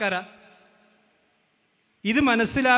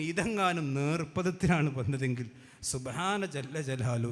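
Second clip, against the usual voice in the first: normal talking voice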